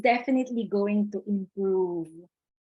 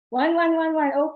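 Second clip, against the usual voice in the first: excited counting; 7 semitones higher, 5 dB louder than usual. Real, not acted. Reading out chat replies.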